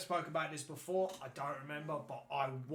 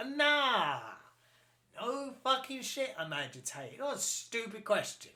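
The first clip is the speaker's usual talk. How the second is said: high hammed-up indignation; mock outrage; 7 semitones higher, 3 dB louder than usual. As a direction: keep the mock rant going. Mock rant continues.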